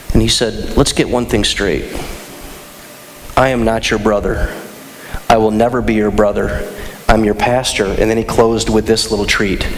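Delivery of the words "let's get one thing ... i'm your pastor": low, measured dressing-down; stern rebuke. Retold or imitated. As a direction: imitate a pastor putting a child in his place. Pastor rebukes a child, retold.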